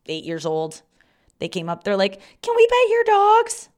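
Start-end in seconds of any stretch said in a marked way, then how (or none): 2.4-3.7 put-on high, loud kid's question; mock kid voice, high and slow; little boy voice. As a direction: ask it like an eager eight-year-old. Kids asking, voiced.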